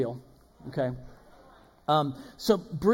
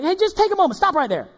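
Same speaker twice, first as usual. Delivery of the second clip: high urgent call to stop; 9 semitones higher, 8 dB louder than usual. Acted. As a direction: call to a rushing friend to stop. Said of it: "What do you say?".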